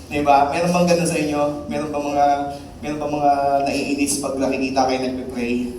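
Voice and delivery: ordinary talk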